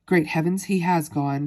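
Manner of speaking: ordinary delivery, nothing marked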